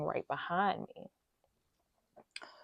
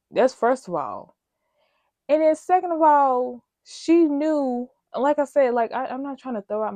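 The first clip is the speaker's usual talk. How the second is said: louder and higher, building indignation; heated rant; 6 semitones higher, 8 dB louder than usual. Real, not acted. Ranting at the supervisor about her son, heated and louder. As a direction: raise the pitch, let it boil over. Angry retort continues.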